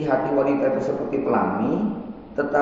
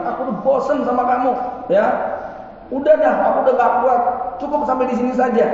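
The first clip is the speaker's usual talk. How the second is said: higher, rushed outburst of a fed-up wife; 9 semitones higher, faster than usual. Acted. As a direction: act out a wife who has had enough, fast and high. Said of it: "kalau suatu saat istri bilang". (istri kesal, sudah nggak tahan)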